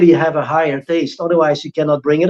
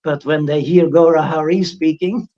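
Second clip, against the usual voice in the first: ordinary delivery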